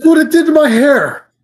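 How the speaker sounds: high, loud comic yell; mock shout. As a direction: bellow it like the racket fried you. Joking about the loud noise.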